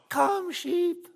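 put-on tiny high-pitched child's call; squeaky little-girl yell. Five-year-old girl calling sheep on the hillside, squeaky little yell. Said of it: "she, in her squeaky little voice, yelled".

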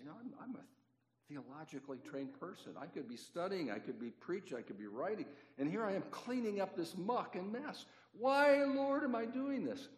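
high-pitched, hammed-up indignant complaint; grumbling. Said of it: "I was kinda grumbling".

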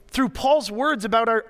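normal talking voice

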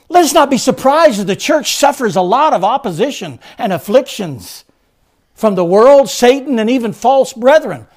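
very loud, high-pitched sermon proclamation; full-force preaching. Acted. Preacher declares to congregation the church shall stand, full force.

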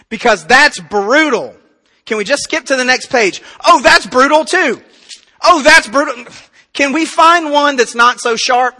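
loud, high mock dismay; arguing with God. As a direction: protest in exaggerated exasperation. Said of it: "me arguing with God".

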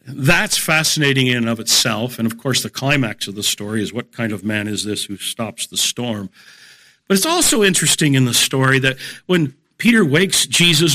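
ordinary delivery

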